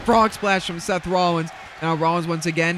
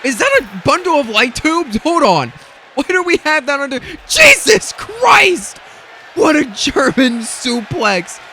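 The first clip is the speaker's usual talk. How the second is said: high, loud disbelieving shouts; shocked outburst; 10 semitones higher, 7 dB louder than usual. Real, not acted. Commentator spotting light tubes, shocked and shouting. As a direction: react like you can't believe the weapon.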